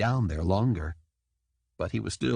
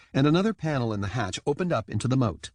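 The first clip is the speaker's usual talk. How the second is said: usual speaking voice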